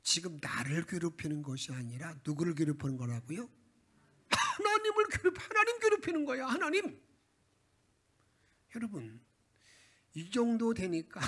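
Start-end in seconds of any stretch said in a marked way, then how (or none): 4.3-7.0 much higher and louder, hammered; climactic preaching cry. Real, not acted. Preacher drives home that Ahaz wearies God, high and loud. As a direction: cry out the point to the church.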